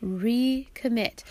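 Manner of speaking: ordinary talk, nothing marked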